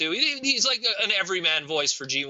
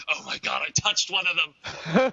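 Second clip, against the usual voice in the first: disgusted Michael Bay; 2 semitones higher, slower than usual. Retold or imitated. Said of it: "spraying his hand, like".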